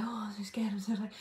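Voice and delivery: mock scared client. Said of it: "you tell me all this stuff, like".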